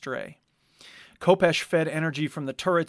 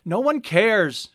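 raised, sharp and impatient; irritated refusal; 7 semitones higher, 4 dB louder than usual. A narrator's reading. Kopesh snapping at the crew, irritated. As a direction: snap back while busy at the controls.

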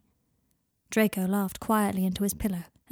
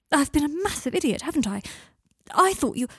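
higher and louder, rattled; anxious outburst; 7 semitones higher, 4 dB louder than usual. Acted. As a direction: blurt it out in panic. Draco panicking, embarrassed.

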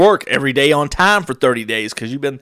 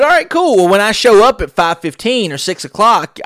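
much higher, louder sarcastic comeback; sarcastic retort; 8 semitones higher, 5 dB louder than usual. Retold or imitated. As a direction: throw it back at him.

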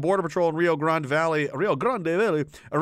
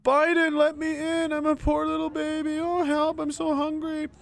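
much higher whiny little-kid voice, hammed up; mock child voice; 11 semitones higher than usual. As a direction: squeak it like a pleading baby, mocking. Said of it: "Get that kid up front with the sign".

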